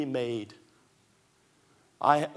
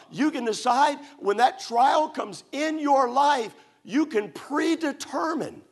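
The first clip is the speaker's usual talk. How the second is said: preaching; 7 semitones higher than usual. Acted. Preacher urging the congregation to choose, in full preaching voice. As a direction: press the point home to the flock.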